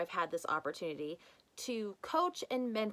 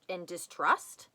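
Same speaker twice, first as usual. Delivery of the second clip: ordinary delivery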